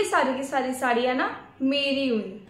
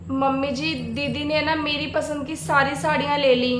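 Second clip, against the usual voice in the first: ordinary talk, nothing marked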